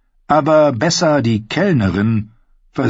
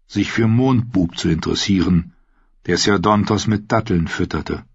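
usual speaking voice

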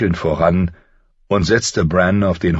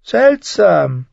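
higher, sly and needling; a higher, sardonic little man's voice; mocking dwarf Tyrion; 12 semitones higher, 4 dB louder than usual. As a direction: talk down to a would-be lord with cool mockery. Tyrion wonders aloud.

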